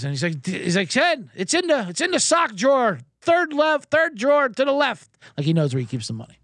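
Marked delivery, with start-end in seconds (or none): 0.9-5.1 high-pitched retold call to another room. Dad tells son where the money is, calling out high. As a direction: imitate a dad calling out directions.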